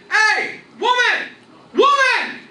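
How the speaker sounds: high, loud hollering; yelling across the farm. Retold or imitated. Old farmer hollers to his deaf wife far away, full yell. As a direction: bellow for the wife across the field.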